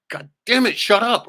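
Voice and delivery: high, loud re-enacted yell; frustrated outburst. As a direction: blow up at someone who won't stop talking. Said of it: "I'm starting to get frustrated".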